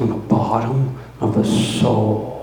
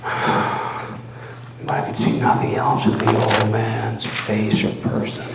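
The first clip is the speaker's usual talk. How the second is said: usual speaking voice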